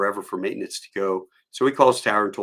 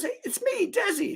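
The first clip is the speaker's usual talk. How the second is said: friendly reintroduction. Retold or imitated. Friend announcing himself.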